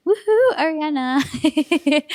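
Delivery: high, loud, drawn-out woohoo; loud, stretched-out celebratory cheer. Real, not acted. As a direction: whoop for a friend from afar. Cheering on a friend.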